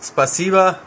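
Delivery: playful mock Russian accent farewell; hammed-up Russian accent goodbye. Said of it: "Grußwort mit russischem Akzent".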